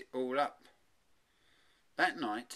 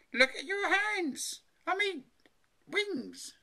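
high, loud, drawn-out mum voice; alarmed mother; 6 dB louder, slower than usual. A narrator's reading. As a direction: exclaim high, then correct yourself. Alarmed mother points, corrects herself.

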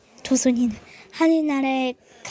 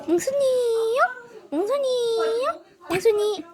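high rising puppet question; 8 semitones higher than usual. Pretend. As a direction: ask in a high character voice. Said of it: "puppet character calling".

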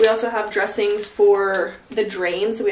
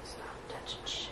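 whisper; 20 dB quieter than usual. Real, not acted. Whispered scolding during drain care.

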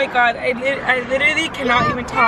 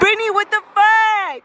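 high, louder scolding; alarmed outburst; 7 semitones higher, 4 dB louder than usual. Real, not acted. Owner to friend after dog fell, high alarmed outburst.

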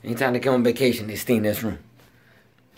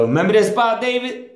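calling out; 11 semitones higher than usual. Real, not acted. Calling to David upstairs.

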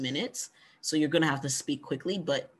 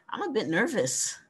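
nervous admission; 7 semitones higher than usual. Shown as a demonstration. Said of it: "it's okay to just say".

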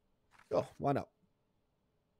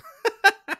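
sudden high burst of laughter; loud high laugh; 12 dB louder than usual. Real, not acted. Guard laughs at a traveller's made-up country. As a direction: crack up at the joke.

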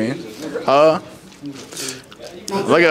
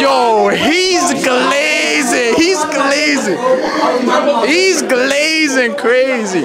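higher, drawn-out, hollered; teasing call-out; 7 semitones higher, much slower than usual. Real, not acted. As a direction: stretch it out and shout. Calls out friend hyping someone.

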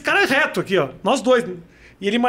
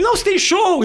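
high-pitched imitated urging; insistent fan; 9 semitones higher than usual. Injured fan reminds the comedian of his show, high insistence. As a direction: mimic a fan brushing off any concern. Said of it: "por insistência dele".

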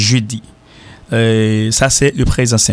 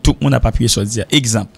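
normal talking voice

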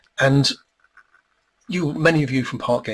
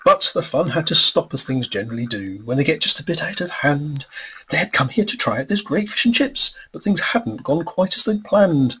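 lilting storybook read-aloud, higher and bouncy; rhyming story narration; 4 semitones higher, 3 dB louder than usual. A narrator's reading. Author reading his rhyming book aloud to an audience, lilting storybook voice. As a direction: read the verse like a children's book.

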